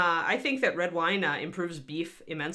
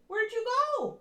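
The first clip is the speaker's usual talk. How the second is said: usual speaking voice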